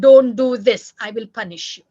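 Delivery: very loud first warning, dropping off sharply; threatening parent. Shown as a demonstration. Parent threatening a child with punishment, stern warning. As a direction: warn hard, then state the threat. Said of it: "We only tell the child".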